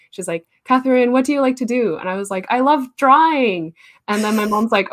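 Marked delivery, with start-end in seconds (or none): 0.6-2.0 lightly voiced mom, higher and quicker; a hint of an eager mom asking her kid; higher-pitched, lightly acted parent; mom's question. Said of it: "my mom took where she's asking me". 2.5-3.7 put-on higher, louder, slow little-kid answer; drawn-out, lightly mocked kid voice; young child self. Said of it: "baby pictures of myself".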